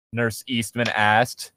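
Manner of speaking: ordinary delivery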